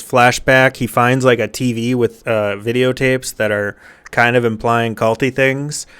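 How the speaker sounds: ordinary talk, nothing marked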